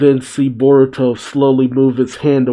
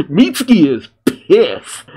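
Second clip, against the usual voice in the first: higher, slower, savouring each word; slow and high, amused fan glee; relishing repeat; 6 semitones higher, slower than usual. Real, not acted. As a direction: say it again, loving the drama. Repeats reaction after laughing.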